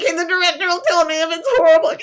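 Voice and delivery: high, squeaky, hurried tick. Acted. Author hoping the director will stop her, high tick voice. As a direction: race through it in a high squeak. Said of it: "talking out like this".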